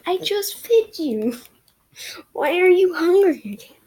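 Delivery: mock-annoyed high voice; mock exasperated self. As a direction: scold her own stomach. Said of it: "I'm like".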